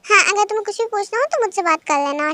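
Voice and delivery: usual speaking voice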